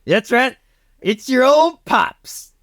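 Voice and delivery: high, drawn-out cowboy voice; cowboy father reveal. Acted. Cowboy tells Michael he is his dad, high drawn-out reveal. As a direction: stretch the words out high, a big reveal.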